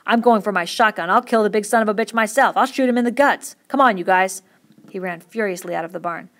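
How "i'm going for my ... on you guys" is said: much louder, raging threats; fury. A narrator's reading. Curly rallying the men to shoot Lenny, furious. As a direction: work up to a murderous rage. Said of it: "He worked himself into a fury".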